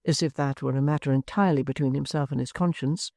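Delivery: ordinary delivery, nothing marked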